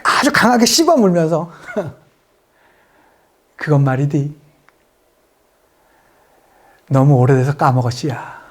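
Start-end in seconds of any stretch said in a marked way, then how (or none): 3.6-8.4 low North Korean-dialect movie line; weary soldier's low-pitched reply; 유승용's film role. Retold or imitated. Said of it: "유승용 씨가 담배를 탁".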